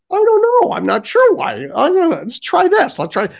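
high, dithering clerk; high mock store-clerk voice; imitated store lady. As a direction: mimic an unsure lady assistant up high. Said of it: "the lady comes over and she's like".

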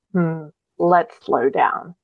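slow, hesitant voice of caution; little inner voice. Acted. The little voice you should listen to, slowly cautioning. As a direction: imitate a cautioning thought, drawn out slowly. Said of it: "that little voice that goes".

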